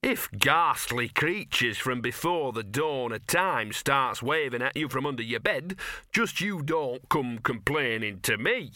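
slow, offended dog voice; talking dog, huffy. Acted. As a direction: warn them off in a sulk.

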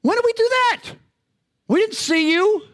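mock disbelief, as if caught off guard; surprised. The righteous protesting to the king, in bewildered surprise. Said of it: "they're like".

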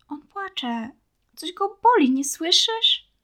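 high, tearful plea, slowing at the end; crying plea. A narrator's reading. Girl pleads with the boy to help the pup, tearfully high. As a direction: beg him to listen through rising tears. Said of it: "Ona też rozpłakała się".

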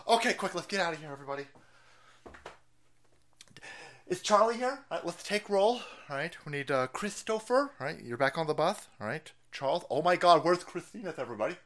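soft, hurried aside to the group; lightly acted organiser counting heads; mock roll call. Host playing secret fed gathering the group, hushed.

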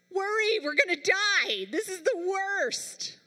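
high, loud hammed-up despair; mock panic. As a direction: wail it as if all is lost, dragging the end. Acting out natural worried reaction.

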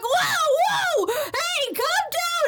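hammed-up yelled calm-down; mock shouted plea. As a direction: shout it as a panicked bystander. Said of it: "And then he's like".

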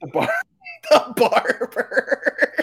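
high, slow, laughing through words. Real, not acted. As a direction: giggle out the barber. Laughing repeats the joke.

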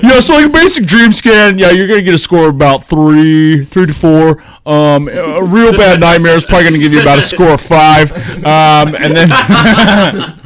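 high, loud doofy technician voice; mock doctor voice. Retelling the movie's sleep doctor, high mocking voice. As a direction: play the clueless dream technician. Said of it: "the guy ... is like".

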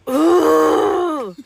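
long, high, loud mock holler; imitated toddler yell. Toddler fed up with sister, imitated high yell. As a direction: let out a small kid's drawn-out yell.

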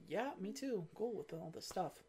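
soft, offhand small talk; bored Bond. Retold or imitated. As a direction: mumble it, not interested. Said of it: "Bond is like".

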